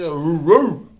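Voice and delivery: loud barking noise, rising. Retold or imitated. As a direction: bark like a dog at a girl. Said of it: "I get to barking at this chick".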